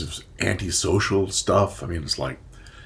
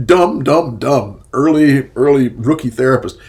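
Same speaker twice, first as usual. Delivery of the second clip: louder self-mocking groan; loud self-scolding; 2 semitones higher, 11 dB louder than usual. Real, not acted. Therapist laughing at his early mistake, loud self-scold. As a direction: berate your rookie self, loud. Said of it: "scolding his younger self".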